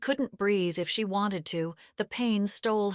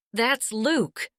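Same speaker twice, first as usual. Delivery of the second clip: raised voice, pointing someone out; calling out; 5 semitones higher, 6 dB louder than usual. Acted. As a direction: call out to him, louder. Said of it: "Annie called out".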